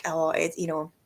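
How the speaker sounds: normal talking voice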